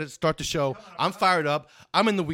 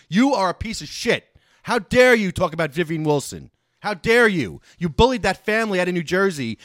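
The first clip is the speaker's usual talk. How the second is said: loud, indignant rant with punched words; fired-up outrage; 4 semitones higher, 7 dB louder than usual. Real, not acted. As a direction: lay into him, louder and higher. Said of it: "I'm fired up".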